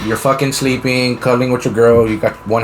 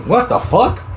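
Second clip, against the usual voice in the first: incredulous; 10 semitones higher, slower than usual. Acted. Reacts to sister's excuse.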